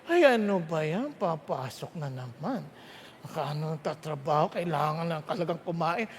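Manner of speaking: low, quiet, dragging grumble of a tired worker; weary grumble. Retold or imitated. Preacher mimics a worker dreading the workday, low weary grumble. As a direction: sigh it out like a worker who hates waking up.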